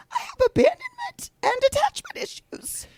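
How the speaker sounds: high, mock-dramatic confession. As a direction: squeak it out mockingly. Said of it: "the biggest reveal".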